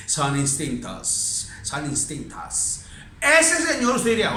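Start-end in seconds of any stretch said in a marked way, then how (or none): 0.0-2.8 lower, softer, slow dismissive voice; mocking cold skeptic. Mimicking a cold modern doctor, slow and dismissive. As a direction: sneer it like a know-it-all. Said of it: "gente de esa hoy en día dice".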